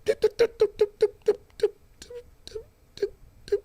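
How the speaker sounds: high, soft repeated doop beeps; satellite beeping. Retold or imitated. Satellites tracking everything, imitated with high beeps. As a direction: mimic a satellite scanning. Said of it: "they be like".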